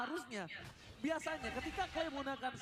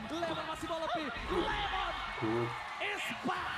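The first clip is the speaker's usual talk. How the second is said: fighting game announcer; 5 dB louder than usual. Real, not acted. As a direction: call the teamfight at full hype. Hyped esports play-by-play climax.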